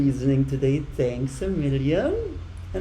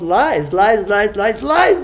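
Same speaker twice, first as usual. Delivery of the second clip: 1 exasperated repetition; 4 semitones higher, 6 dB louder, slower than usual. Real, not acted. Ranting against lies, repeating.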